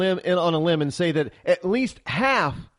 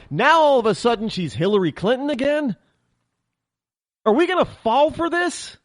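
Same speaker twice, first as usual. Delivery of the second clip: worked up; 5 semitones higher than usual. Real, not acted. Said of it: "I'm getting worked up over this".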